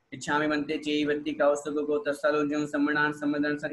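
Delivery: fast, gabbled prayer; hurried, slurred mock recitation; rushed recitation. Shown as a demonstration. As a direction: rattle it off carelessly. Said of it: "इतनी fast बोलोगे तो अर्थ भी समझ में नहीं आएगा".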